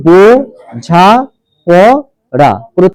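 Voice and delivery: বোঝাপড়া slowly broken up, a gap after each syllable. Shown as a demonstration. Teacher dividing the word for the class, বোঝাপড়া syllable by syllable.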